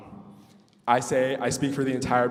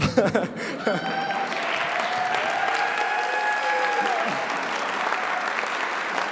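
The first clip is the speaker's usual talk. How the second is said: breathless giggle, much higher; long high giggling laugh. Real, not acted. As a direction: laugh it off, high and airy, embarrassed. Parents stand up, crowd reacts.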